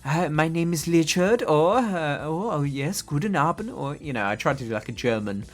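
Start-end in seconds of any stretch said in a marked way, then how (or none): 0.0-1.4 drawn-out, hammed-up camp German; slow mock German accent, very camp. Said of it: "very camp German accent". 2.2-3.7 put-on lightly camped-up German accent; mock camp German. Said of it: "very camp German accent".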